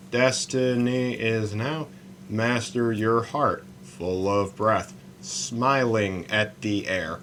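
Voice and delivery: slow, soft haiku reading; poem recital. Acted. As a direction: savour each line of the poem. Recites his haiku slowly.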